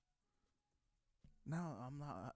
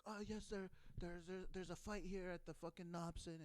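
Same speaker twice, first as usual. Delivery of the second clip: mock frightened caller; 6 semitones higher than usual. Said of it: "all frightened".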